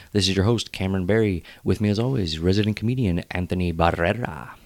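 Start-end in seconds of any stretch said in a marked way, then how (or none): none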